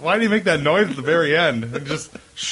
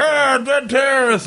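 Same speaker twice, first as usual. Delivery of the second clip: high, hammed-up puppet cry; skeleton dummy; 11 semitones higher than usual. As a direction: screech it out as a silly ventriloquist's dummy. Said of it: "skeleton dummy".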